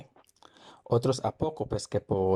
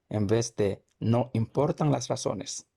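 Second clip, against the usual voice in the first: ordinary talk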